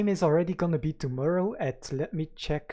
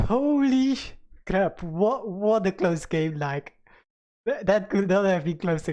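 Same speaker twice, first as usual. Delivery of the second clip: excited; 4 semitones higher, 3 dB louder than usual. Real, not acted. Reacting to the close win.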